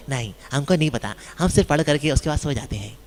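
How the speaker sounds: a lightly voiced, innocent, careless reader; naive reader. Casual reader admits only reading before sleep, mildly sheepish.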